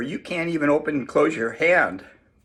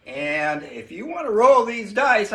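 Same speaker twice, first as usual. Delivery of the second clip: ordinary talk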